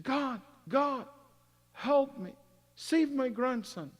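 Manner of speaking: high-pitched, drawn-out mock cry for help; imitated plea to God. Young man retells a cry to God to save a grandson, imitated pleading. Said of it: "He screamed at God".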